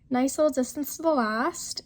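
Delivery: normal talking voice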